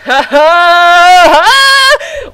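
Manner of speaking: slow, high, loud cackle; hammed-up villain laugh; drawn-out theatrical ha ha ha; mock maniacal laugh. Mock evil laugh in reading.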